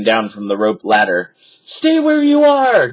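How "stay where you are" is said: high, loud, slow warning; Jack shouting. A narrator's reading. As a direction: order her to stay back. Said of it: "Jack shouted".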